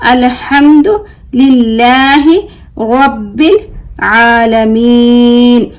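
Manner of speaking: अल्हम्दुलिल्लाहि with a light L, long vowels stretched; thin L recitation. Shown as a demonstration. Teacher modelling अल्हम्दुलिल्लाहि for pupils, light L in slow recitation. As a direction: chant अल्हम्दुलिल्लाहि slowly, drawing out आलमीन to a stop. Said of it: "इसको भी बारीक पढ़ेंगे".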